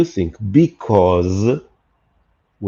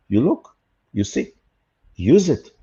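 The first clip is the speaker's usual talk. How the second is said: normal talking voice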